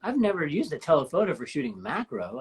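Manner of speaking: normal talking voice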